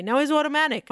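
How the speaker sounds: ordinary talk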